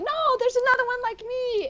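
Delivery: very high, gushing; light up at the news; excited. Retold or imitated. Excited discovery.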